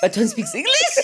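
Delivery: high, incredulous laughing question. Real, not acted. Cohost reacting to talk of a dog's language, laughing. Laughing disbelief at dog speaking English.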